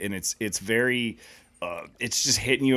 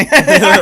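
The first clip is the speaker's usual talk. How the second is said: high, loud ghoulish cackle; 16 dB louder than usual. Pretend. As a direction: cackle like a horror host.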